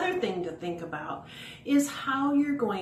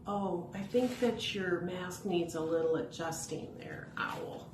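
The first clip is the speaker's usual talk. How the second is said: whisper; 5 dB quieter than usual. Real, not acted. Aside fixing puppet mask.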